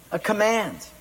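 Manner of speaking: ordinary talk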